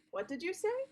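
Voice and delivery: "What did you say?" with the pitch rising up on "say"; rising question tune. Shown as a demonstration. Teacher shows kids a question tone going up, rising. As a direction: lift "say" high like a real question. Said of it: "my tone go up".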